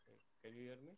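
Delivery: faint, distant mic audio; barely audible. Real, not acted. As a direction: voice almost lost, mic too far. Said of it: "It's very low".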